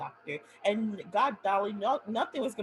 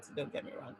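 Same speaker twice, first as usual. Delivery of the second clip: much softer, under the breath; whispered aside; 9 dB quieter, faster than usual. Real, not acted. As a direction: slip it in as a whisper.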